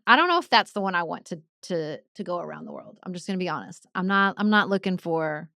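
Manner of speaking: ordinary talk, nothing marked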